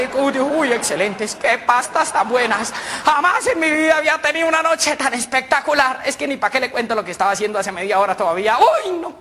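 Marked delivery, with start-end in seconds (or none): 0.4-6.0 relishing, higher-pitched gush; delighted raving. Acted. Customer raves to the pharmacist about his night, delighted raving. 8.6-9.2 high, gushing outburst; ecstatic exclamation. Acted. Customer recalls a spectacular night to the pharmacist, ecstatic exclamation.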